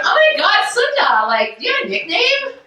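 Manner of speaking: put-on high excited valley girl voice; gushing LA girl. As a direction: squeal it like a Heather.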